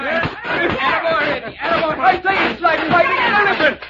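high, very loud cheering; excited shouting. Acted. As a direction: yell encouragement over the struggle. Cheering amid the brawl.

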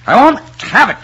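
high, loud, explosive refusal; enraged outburst; furious shout. Acted. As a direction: shout it at the top of your voice. Same refusal, shouted in anger.

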